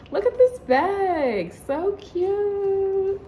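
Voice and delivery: high, slow delighted squeal; excited gushing. Real, not acted. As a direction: gush over it like a fan. Gushing over Hello Kitty bag.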